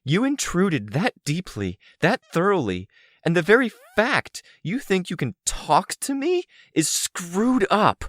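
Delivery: high-pitched angry tirade, building; harsh, fervent anger. A narrator's reading. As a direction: let the accusations pour out with rising fervor. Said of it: "so I spoke with more fervor, more harshness in my voice".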